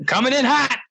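high-pitched warning yell. Retold or imitated. Sliding down the trail warning hikers, yelling. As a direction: call it out high, like a runaway slider. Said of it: "I kept yelling".

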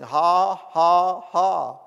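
loud, separate, emotionless "ha"s; dry forced laugh. Retold or imitated. Preacher imitates a sick man laughing through symptoms, dry forced laugh. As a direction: fake a laugh with no feeling attached. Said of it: "No feelings attached at all".

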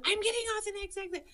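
high, urgent announcement; urgent cry. Retold or imitated. Telling husband over walkie-talkie, high urgent cry. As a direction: call it out in a panic.

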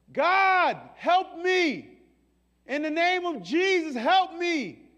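loud, high desperate plea; crying out to God. Retold or imitated. Child of God crying to God, loud desperate plea. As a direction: cry out for help.

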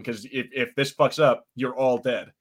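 normal talking voice

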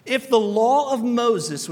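slow, weighty opening clause; slow emphatic. Acted. Preacher to congregation, slow and weighty. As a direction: lean on each word slowly.

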